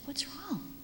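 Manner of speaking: hushed whispered question; gentle whisper. Retold or imitated. Teacher asking a tearful girl what is wrong, whisper. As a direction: ask it in a soft whisper. Asking upset child softly.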